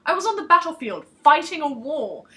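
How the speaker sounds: a soldier loudly defending himself before the village; protesting. Acted. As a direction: raise your voice to make them believe you. Protesting his innocence loudly.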